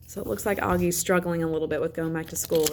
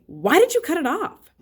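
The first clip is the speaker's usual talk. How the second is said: high, sharp accusing question, louder; angry sister; 10 semitones higher, 5 dB louder than usual. A narrator's reading. Sister confronting Augie about his cut braid, angry and high. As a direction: demand it of her brother like she's mad. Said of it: "almost like she was angry".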